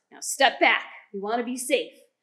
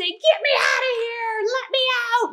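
much higher, louder squeaky cry to be freed; trapped gas pleading; 10 semitones higher, 5 dB louder than usual. Pretend. Teacher voicing the trapped gas, high desperate plea. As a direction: squeal like something stuck in a jar.

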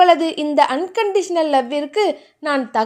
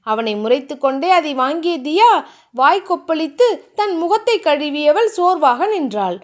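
ordinary delivery

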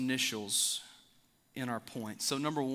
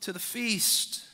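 loud, raised and high, calling the guests in; king's proclamation; 6 semitones higher, 4 dB louder than usual. Acted. King sends servants, calling loudly to the guests. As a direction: announce it like a king summoning guests to his feast.

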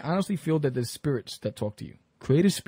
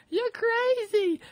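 much higher, slow, squealing through laughter; laughing mother-in-law; 4 dB louder, much slower than usual. Retold or imitated. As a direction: squeal it like you can't breathe from laughing. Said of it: "she's crying".

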